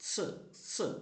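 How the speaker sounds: "സ്" as a short, hissed sound; letter sound of X. Shown as a demonstration. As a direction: say "സ്" as the sound taught for X. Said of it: "പ്രൊനൗൺസിയേഷനാണ്".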